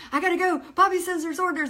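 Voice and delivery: high, loud retold announcement; urgent excitement. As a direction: burst out with the news, needing to leave now. Announces she must rush off.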